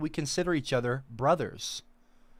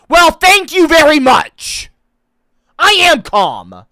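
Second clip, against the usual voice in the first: sudden loud, high, drawn-out retort; indignant outburst; 11 semitones higher, 17 dB louder, slower than usual. Real, not acted. Answering viewers who like calm [name], indignant shouting. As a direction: belt it out slowly, offended. Stung by being called calm.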